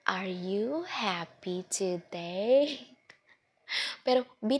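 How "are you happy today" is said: very slow, near-whispered questions; speaking slowly. Shown as a demonstration. Teacher asking a young student slowly. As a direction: stretch every word out gently for a small child. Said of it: "So, slowly".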